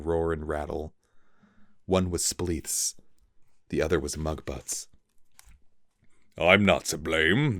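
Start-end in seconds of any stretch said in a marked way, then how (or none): none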